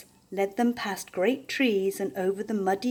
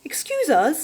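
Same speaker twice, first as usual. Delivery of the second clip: politely; 8 semitones higher, 5 dB louder than usual. A narrator's reading. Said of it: "said the porcupine politely".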